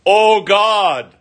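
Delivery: crying out. Retold or imitated. Psalmist crying aloud to God, loud and high. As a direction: shout it up to heaven. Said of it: "He is shouting out".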